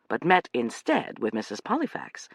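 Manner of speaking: ordinary delivery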